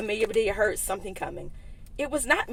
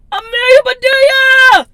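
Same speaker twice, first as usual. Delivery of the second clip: very loud, much higher cry of her name; shouting her name; 17 dB louder than usual. Acted. Mister Rogers shouting at Amelia after the wrong turn, loud. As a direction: bellow her name in alarm. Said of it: "shouted Mister Rogers".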